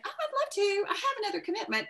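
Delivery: high, fast, sing-song fake politeness; mock polite excuse. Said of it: "instead of saying".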